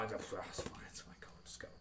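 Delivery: quick whispered reassurance, barely audible; hushed dad telling her to just go; whispered urging. Retold or imitated. Dad voiced urging quietly.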